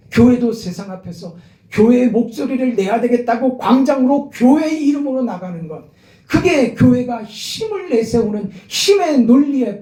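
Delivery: rising sermon climax. Real, not acted. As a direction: build it up to the congregation.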